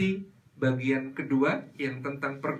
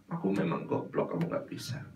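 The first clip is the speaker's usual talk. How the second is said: put-on a child's whispered self-reproach; quiet whispered self-insult; whispered self-blame; 6 semitones lower, 8 dB quieter than usual. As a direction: whisper it, hitting yourself down. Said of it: "itu mukulin kepala".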